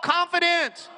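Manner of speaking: shout. Shown as a demonstration. Preacher leading the congregation in a call, shouted response. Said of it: "Someone shout".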